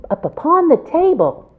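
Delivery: ordinary delivery, nothing marked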